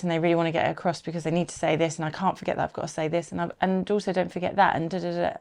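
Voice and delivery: fast, run-on gabbling; a nervous presenter rushing through everything; rushed anxious gabble. Shown as a demonstration. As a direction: cram it all in without a breath. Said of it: "when they talk like this".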